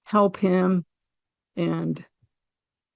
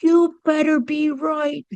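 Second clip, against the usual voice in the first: higher and slow, half-teasing challenge; dying man's warning; 8 semitones higher, slower than usual. Retold or imitated. Dying brother-in-law warning the speaker, slow higher mock challenge. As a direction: point and warn him, stretched out. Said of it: "he points at me and goes".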